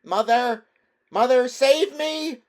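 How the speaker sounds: high, desperate full-voiced scream. A narrator's reading. Drowning boy screaming to his mother for help. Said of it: "and he was screaming".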